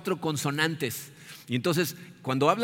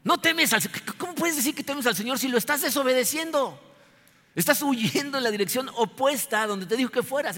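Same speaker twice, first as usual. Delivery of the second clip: indignant rebuke; 9 semitones higher than usual. Acted. Readers to Jonah, indignant and much higher rebuke. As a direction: call out the hypocrite in disbelief. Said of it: "nosotros le podríamos decir".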